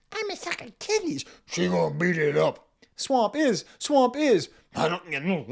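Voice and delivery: high, loud wobbling rapper voice imitation. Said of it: "I'm going to try to imitate the voice modulations".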